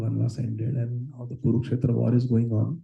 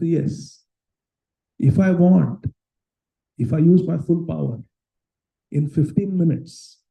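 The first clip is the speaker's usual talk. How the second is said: put-on a proud old warrior, louder and higher; boasting Bhishma; 4 semitones higher, 6 dB louder than usual. Bhishma boasting to Duryodhana of his power, proud and loud. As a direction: declare your full power with pride.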